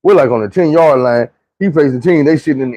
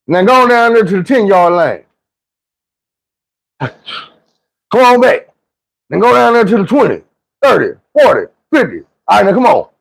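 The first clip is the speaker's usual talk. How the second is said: higher barked orders; coach commands; 6 semitones higher than usual. Retold or imitated. Coach sends the player yard by yard, higher and commanding. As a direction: run him up and down the field. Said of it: "He like".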